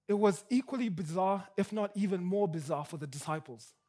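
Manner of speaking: usual speaking voice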